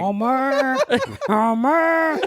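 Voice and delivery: nasal-lipped "Homer." called twice, Marge-style; calling Homer with the upper lip thing. Retold or imitated. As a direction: call "Homer." twice like a cartoon wife. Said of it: "Watch when she does it".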